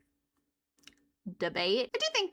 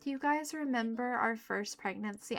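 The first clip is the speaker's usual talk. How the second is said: ordinary delivery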